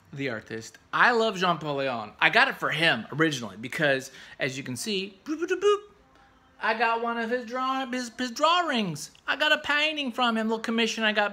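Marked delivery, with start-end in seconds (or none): 5.2-5.8 high mock boops over hidden words; playful censor boops, much higher; vocal censor bleep. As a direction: bleep it out with your voice.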